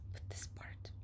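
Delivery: hushed whisper, hurried; whispered "Oh, okay, I will continue reading.". Real, not acted. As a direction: whisper it quickly to yourself.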